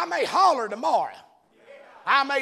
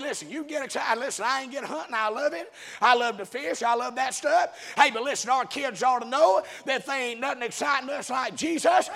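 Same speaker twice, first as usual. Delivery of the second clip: normal talking voice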